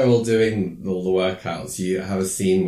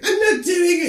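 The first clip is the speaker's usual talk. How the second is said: very high, loud bratty refusal; shrill refusal; 7 dB louder than usual. Retold or imitated. Chris shrieking a refusal at the coach. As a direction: squeal it like a tantrum. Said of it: "And she's like".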